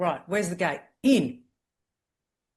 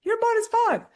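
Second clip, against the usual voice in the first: teasing high voice; 8 dB louder than usual. Retold or imitated. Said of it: "I go".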